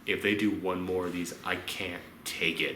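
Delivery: low, soft aside to a friend; a hushed confession in the cinema; muttered aside. Retold or imitated. Leaning over to a friend, a low soft mutter. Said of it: "I leaned over to you and I said".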